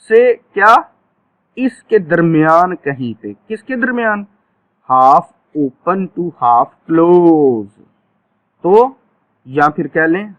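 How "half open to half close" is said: very slow, each word spaced out; stretched-out classroom dictation; slow drill phrase. Shown as a demonstration. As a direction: dictate it slowly for the class to repeat. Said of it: "teacher drills the phrase".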